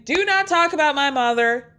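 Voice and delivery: high-pitched mock scream; retold screaming. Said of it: "Candace starts screaming".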